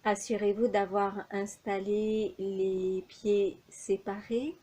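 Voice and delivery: usual speaking voice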